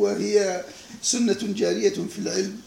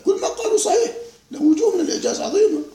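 laughing; 13 semitones higher, 6 dB louder than usual. Real, not acted. Lecturer laughing while praising scholars.

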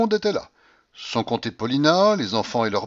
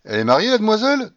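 fast, loud, high, nosy question; blunt old grandmother; 11 semitones higher, 7 dB louder, faster than usual. A narrator's reading. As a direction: fire it off with no ceremony. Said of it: "demanda-t-elle sans autre cérémonie".

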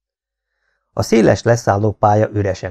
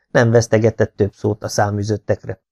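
ordinary delivery, nothing marked

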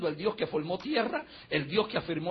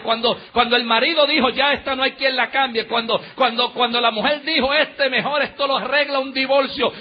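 loud, raised preaching voice, driving repetitions; full-force preaching; 5 semitones higher, 10 dB louder than usual. Real, not acted. Preacher telling congregation God still speaks in despair, full-force preaching. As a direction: preach it at full volume to the climax.

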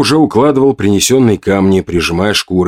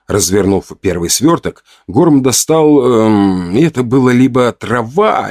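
usual speaking voice

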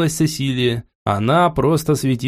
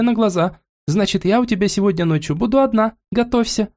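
put-on high, playful spoiled young wife; girlish voice pressing her point; капризная жена; 7 semitones higher than usual. Cecilia chatters her news. (игривая капризная девушка)

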